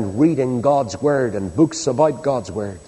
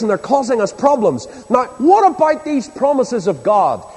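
raised, hurried Ulster grumble to an angel; indignant protest; 7 semitones higher than usual. Acted. Gideon challenges the angel about God's promises, indignant protest. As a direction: reel off the grievances, then demand an answer.

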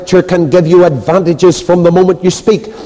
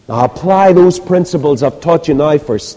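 ordinary talk

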